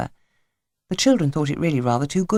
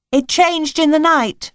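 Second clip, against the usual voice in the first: put-on girl voice; 11 semitones higher, 5 dB louder, slower than usual. A narrator's reading. Little girl voiced in story.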